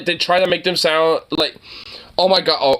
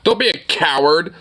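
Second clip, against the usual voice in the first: ordinary talk, nothing marked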